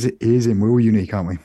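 normal talking voice

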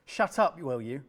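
sharp, loud shut up at a taunting brother; angry outburst. Acted. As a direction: snap back at him, fed up. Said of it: "Obed cried".

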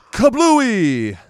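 high, loud cartoon kaboom; explosion sound. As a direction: burst it out like a blast. Mimics the missile explosion.

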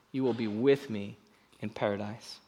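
soft, gentle reassurance; Jesus comforting the thief, softly; tender promise. Acted. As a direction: say it quietly, like a comfort.

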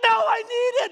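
put-on high, loud childish insisting; whiny protest. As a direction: insist like a pouting kid. Us whining to God.

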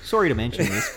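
jokey Canadian apology lilt; polite Canadian. Acted. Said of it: "the Canadian would have told me".

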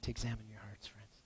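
hushed whisper, fading to nothing. Real, not acted. Pastor inviting the congregation to self-examination, whispered. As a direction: breathe it softly to the room.

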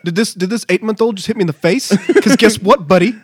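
playful pumped-up bravado, laughing; a laughing tough guy ready to fight; hyped mock gym bro. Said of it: "just be like".

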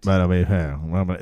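a hint of the friend's own voice; [name]'s voice. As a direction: drop into a casual low mumble. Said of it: "then it was [name] all of a sudden".

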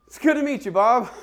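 very high, loud, strained greeting; awkward politeness. Acted. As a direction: squeak out a mock-polite reply in shock. Awkward reply to oversharing stranger.

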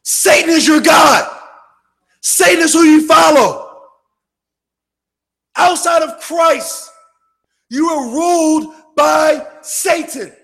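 loud, high fiery preaching; preaching at full force. Acted. Preacher warning the congregation about Satan, loud fiery preaching. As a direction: thunder it from the pulpit.

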